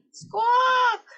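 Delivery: very high, loud squawk; bird squawk. Acted. Gull squawking sadly at the penguin, sharp high squawk. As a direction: screech it like a gull.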